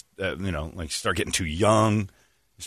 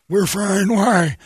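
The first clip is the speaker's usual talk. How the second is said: mock customer; 12 semitones higher, 5 dB louder, much slower than usual. Acting out a customer.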